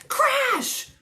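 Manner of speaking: loud, high burst on the word; big storybook crash; crashing sound word. A narrator's reading. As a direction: make the crash explode.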